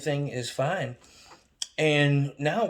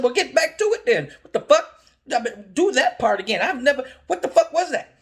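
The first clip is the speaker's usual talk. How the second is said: loud, much higher incredulous outburst; exasperated yell; 12 semitones higher, 8 dB louder than usual. Retold or imitated. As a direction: shout it in disbelief.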